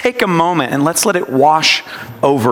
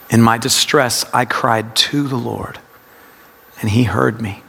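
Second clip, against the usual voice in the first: TO fully sounded and leaned on; low meditative reading, weight on TO; stress on TO; 6 semitones lower than usual. Shown as a demonstration. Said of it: "it's a practice of word emphasis".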